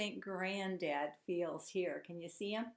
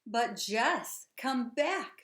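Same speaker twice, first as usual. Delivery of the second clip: loud, higher call after her; shouted; 4 semitones higher, 8 dB louder, much slower than usual. Acted. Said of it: "shouted Granddad".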